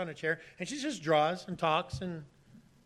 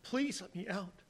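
soft pleading; 9 dB quieter than usual. Retold or imitated. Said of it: "the guy is pleading with her".

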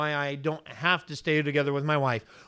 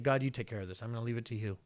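low, soft surrender to God; trusting prayer; 6 semitones lower, 12 dB quieter, faster than usual. Acted. Preacher voicing Hezekiah leaving his trouble to God, soft and trusting. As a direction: hand it over quietly, low and easy.

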